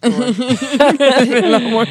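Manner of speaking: laughing through the words; amused laugh while repeating. Real, not acted. Laughing at friend's remark.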